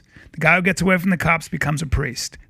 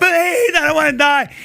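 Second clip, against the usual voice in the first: terrified begging; 12 semitones higher, 6 dB louder than usual. Retold or imitated. Gangster dragged to the chair, screaming for help. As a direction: beg for your life.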